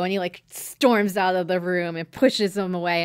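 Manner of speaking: ordinary talk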